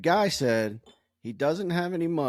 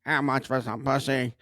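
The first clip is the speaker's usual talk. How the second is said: throat voice box. Retold or imitated. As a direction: imitate a robotic voice box. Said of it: "he had the damn thing".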